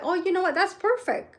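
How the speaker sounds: quoting the lawyer. Retold or imitated. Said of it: "she's like".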